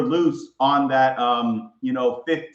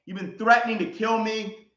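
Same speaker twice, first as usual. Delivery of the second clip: ordinary delivery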